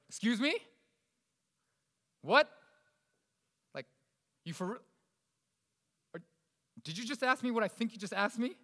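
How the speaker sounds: high-pitched, affronted questioning; acting out someone taken aback and pissed off; offended disbelief. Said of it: "They're pissed off now".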